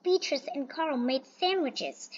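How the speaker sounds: plain reading voice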